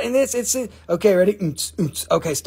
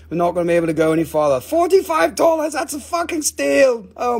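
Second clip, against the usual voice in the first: ordinary talk, nothing marked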